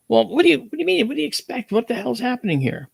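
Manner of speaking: high-pitched, flustered protest; retold incredulous questions in a high voice; exasperated. Retold exasperated reply to the kid.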